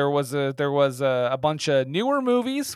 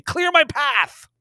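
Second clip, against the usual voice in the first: high, slow mock-heroic order; mock grand command; 9 semitones higher, 3 dB louder, slower than usual. Co-host ordering Jason to explain, mock-grand.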